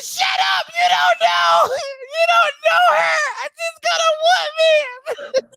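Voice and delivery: very high, slow, wailing shriek; retold hysterical screaming. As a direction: scream down the bystander as a frantic kid. Retold child screams at bystander.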